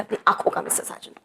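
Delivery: whispered name. Acted. Boss finishing her scolding of Sajan, whispered. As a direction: breathe his name, voiceless.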